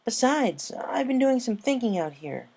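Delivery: high, bright tomboy pony voice; upbeat sporty pony, carefree; Rainbow Dash voice. A narrator's reading.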